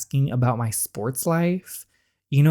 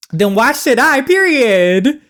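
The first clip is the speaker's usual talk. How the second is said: sharp, high, loud comeback; defiant snap at the absent father; indignant retort; 11 semitones higher, 9 dB louder, slower than usual. Acted. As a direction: throw it back in his face. Snapping back at the father.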